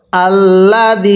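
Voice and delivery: very slow, drawn-out melodic recitation; slow chanted tajweed reading; Quran recitation. Shown as a demonstration. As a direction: recite each syllable long and sung. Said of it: "কিভাবে পড়ব?".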